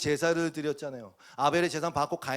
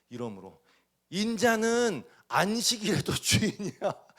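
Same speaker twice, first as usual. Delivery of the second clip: slow, louder, laughing through 인자는 안식일에도 주인이야; laughing reading; 4 semitones higher, 4 dB louder, slower than usual. Real, not acted. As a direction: chuckle as you read 주인이야. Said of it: "얼마나 코미디 같".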